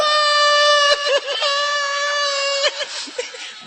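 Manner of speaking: very high screaming laugh; shrieking laugh. Real, not acted. Huge burst of laughter.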